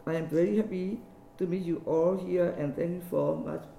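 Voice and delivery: ordinary delivery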